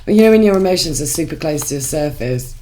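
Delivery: ordinary delivery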